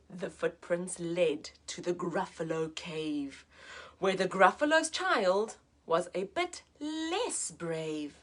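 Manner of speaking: slow, hushed picture-book reading; soft slow storyteller voice; slow story narration. A narrator's reading. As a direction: read it slowly, like a bedtime story. Reads the story aloud.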